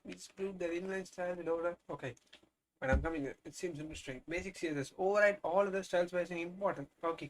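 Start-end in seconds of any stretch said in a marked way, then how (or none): none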